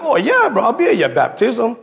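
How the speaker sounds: high, eager yes; light up at the invitation; happy agreement. Retold or imitated. [name] eagerly accepts the baptism invite, high and happy. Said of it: "I'm like".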